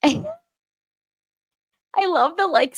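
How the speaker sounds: high laughing gush through the words. Real, not acted. Laughing while praising the account.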